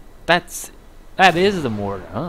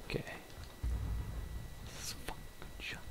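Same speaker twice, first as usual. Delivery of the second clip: whisper; 20 dB quieter than usual. Real, not acted. Gamer sneaking in a shooter.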